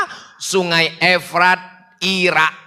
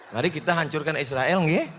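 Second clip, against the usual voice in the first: soft, quicker, rising mock-polite request; lemah lembut dibuat-buat; 3 semitones lower, 9 dB quieter, faster than usual. Shown as a demonstration. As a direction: ask it meekly, lifting at the end. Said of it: "mau ngomongnya lemah lembut". (ajakan halus agak cepat, pura-pura santun)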